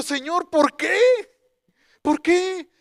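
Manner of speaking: high, loud, exasperated plea; acting out a wounded complaint; indignant protest to God. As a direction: cry out a drawn-out why in retold frustration. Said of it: "me enojé y dije".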